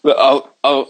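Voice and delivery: usual speaking voice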